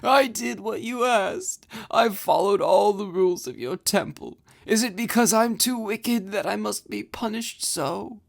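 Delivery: put-on high, weepy pleading girl voice; tearful Ozma. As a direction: whine it out, hurt and guilty.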